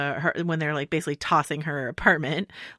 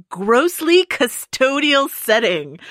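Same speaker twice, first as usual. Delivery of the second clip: slow, emphatic, higher and louder line reading; imitating Nicole Kidman; 8 semitones higher, 7 dB louder, much slower than usual. Podcast host quoting her favourite Kidman line, slow and emphatic. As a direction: savour each word like a crisp legal put-down. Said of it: "Nicole Kidman saying".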